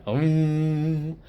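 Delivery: high, held horn imitation; imitated horn blare. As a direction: mimic a blaring horn with the voice. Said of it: "suddenly a horn blared".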